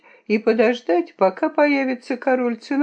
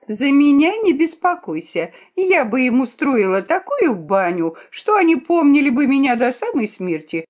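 high, spirited threat; threatening; 6 semitones higher, 4 dB louder than usual. A narrator's reading. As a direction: threaten boldly in a raised princess voice. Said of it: "грозила королевна". (грозить высоким задорным голосом)

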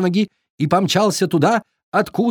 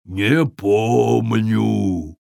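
slow giant; 3 semitones lower, much slower than usual. A narrator's reading. Giant forgets.

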